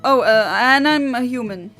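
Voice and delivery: ordinary delivery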